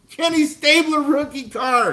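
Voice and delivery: high, giddy excitement over the cards; can barely contain the hype; thrilled. Real, not acted. Celebrating the rookie card.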